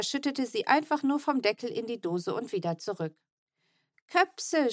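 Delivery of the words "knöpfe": put-on high delighted little-boy shout of Köpse; happy shout. A narrator's reading. Little Mo shouting at his button tin, overjoyed. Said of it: "schrie Mo".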